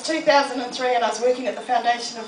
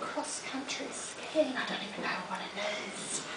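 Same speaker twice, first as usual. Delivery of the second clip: soft, unsure inner thought; puzzled over a skiing invite; quiet; 12 dB quieter than usual. Retold or imitated. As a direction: mull it over under your breath.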